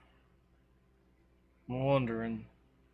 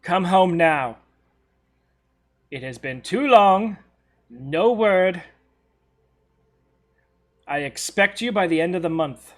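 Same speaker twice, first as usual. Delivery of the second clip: loud, stern mother demanding return; 4 semitones higher, 9 dB louder than usual. Acted. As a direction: order them home like a strict parent. GM voices mother's stern reply.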